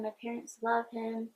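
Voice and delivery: ordinary delivery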